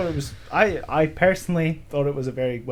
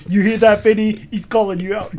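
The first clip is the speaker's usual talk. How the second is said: loud, high teasing shout; mock call-out; 8 semitones higher, 10 dB louder than usual. Taunting an absent hard man for a friend, loud teasing shout. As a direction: yell it like you want him to hear. Calling out to absent Vinnie Jones.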